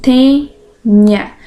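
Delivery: "tênia" slowly split in two; syllable by syllable. Shown as a demonstration. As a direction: pause between the syllables of "tênia". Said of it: "Então, olha lá".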